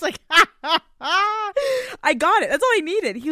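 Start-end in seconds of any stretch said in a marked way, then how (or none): 0.2-0.8 short high laugh; burst of laughter. Real, not acted. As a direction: laughs out loud. Laugh alone.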